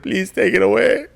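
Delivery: high, strained pleading; pained plea. Retold or imitated. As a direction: beg for the pain to stop. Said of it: "I was like".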